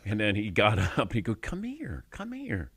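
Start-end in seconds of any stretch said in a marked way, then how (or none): 1.3-2.7 much higher, much softer coaxing; Will coaxing a crying girl, soft and tender; gentle coaxing. Retold or imitated. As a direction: soothe a crying child to come closer.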